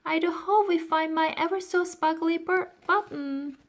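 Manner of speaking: put-on high, loud hopeful girl; Florentine's voice. A narrator's reading. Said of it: "said Florentine".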